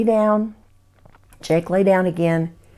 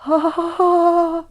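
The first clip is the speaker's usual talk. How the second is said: eerie high laugh; 9 semitones higher than usual. Acted. The creature's laugh.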